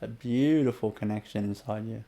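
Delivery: unhurried inner-journey guidance; guided meditation. Acted. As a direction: guide the listener gently inward.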